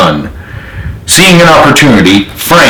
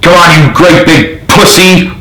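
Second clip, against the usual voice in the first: higher mocking taunt; 5 semitones higher than usual. A narrator's reading. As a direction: goad him, sneering. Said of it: "Richard taunted".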